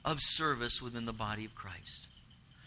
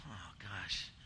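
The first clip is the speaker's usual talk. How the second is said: low, muted groan of dismay; weary dismay; 8 semitones lower, 12 dB quieter than usual. Retold or imitated. Dad seeing his son crying after skating, quietly dismayed. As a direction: let it drop low and very quiet.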